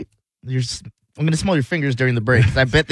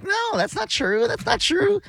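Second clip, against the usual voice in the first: put-on giggling girl; 5 dB quieter, slower than usual. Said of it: "she's giggling".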